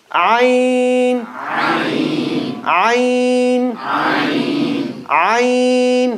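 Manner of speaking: Ayn drawn out from the middle of the throat; model letter ayn. Shown as a demonstration. Teacher drilling Ayn for the class to repeat. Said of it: "Very middle of the throat".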